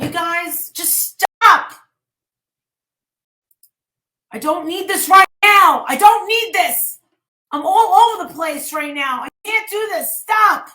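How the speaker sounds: high, loud, near tears pleading; upset streamer shouting at chat to stop; overwhelmed outburst. Real, not acted. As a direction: beg them to stop, at the end of your rope.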